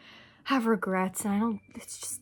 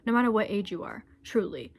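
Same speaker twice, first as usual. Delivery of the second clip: usual speaking voice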